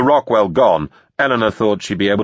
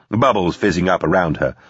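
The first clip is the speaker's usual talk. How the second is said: ordinary delivery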